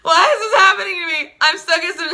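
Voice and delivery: high, loud wail through laughter; hammed-up hysterical crying out; mock screaming panic. Retelling the heroine's panic attack, laughing mock scream. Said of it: "She's basically screaming".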